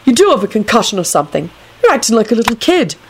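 stern. A narrator's reading. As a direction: chide with a touch of sternness. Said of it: "Neville said sternly".

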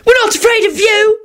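put-on very high, scornful child's voice; disgusted bark. A narrator's reading. Timothy to the gunmen, disgusted bark. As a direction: pipe it out in disgusted defiance. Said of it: "barked out in a disgusted voice".

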